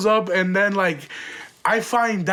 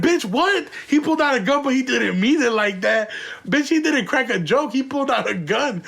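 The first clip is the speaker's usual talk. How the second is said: incredulous; 5 semitones higher than usual. Retold or imitated. Mocking the wife's excuse, incredulous and raised. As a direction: throw her excuse back at her, stunned.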